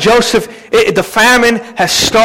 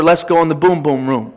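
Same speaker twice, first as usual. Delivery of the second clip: mock seductive wife; 7 semitones lower, 4 dB quieter than usual. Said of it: "That's King James for".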